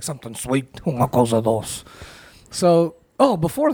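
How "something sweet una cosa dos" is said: put-on character voice. King character answers in foreign phrase.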